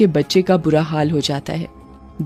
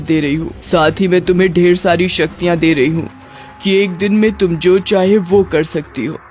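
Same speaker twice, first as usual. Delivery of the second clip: measured, commanding witch queen; witch queen, authoritative. Acted. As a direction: grant permission like a ruler. Queen witch addresses Lali.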